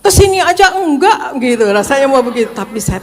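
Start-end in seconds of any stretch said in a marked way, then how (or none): 0.0-1.4 the snap at her husband that she held back; pretend snap back. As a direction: fire it back as if finally losing patience. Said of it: "rasanya tuh udah mau bentak juga".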